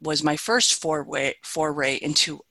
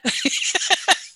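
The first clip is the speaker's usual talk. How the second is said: breathy laugh; 14 semitones higher than usual. Real, not acted. Laughs after her own joke.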